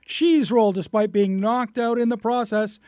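loud, lifted headline easing into the details; lively news reader. Acted. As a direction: punch the opening like a radio news story. Reads a news story.

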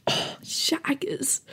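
slow, soft whisper; cringing whisper. Real, not acted. Cringing at the memory.

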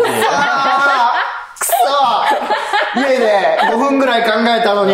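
high, loud playful "damn it" wail, half laughing; comic angry yell, high and drawn out; mock furious outburst. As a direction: howl in hammed-up anger, laughter breaking through. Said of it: "めっちゃ怒ってる".

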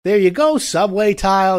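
loud, high and slow, selling it hard; eager tile clerk. Retold or imitated. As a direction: belt it like a cheerful salesman pointing the way. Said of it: "Person's like".

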